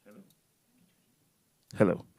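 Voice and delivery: usual speaking voice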